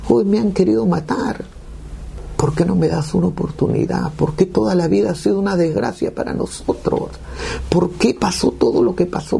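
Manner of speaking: high-pitched anguished plea, volume held back; pleading lament, pitched high throughout; retold crying out. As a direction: re-enact desperate questions thrown up at God. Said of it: "comienzo a gritar y le digo".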